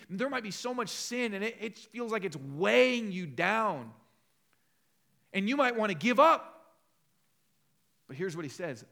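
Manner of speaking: loud, high, impassioned preaching; fervent preaching. Acted. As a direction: press the point home to the congregation. Preaching with force.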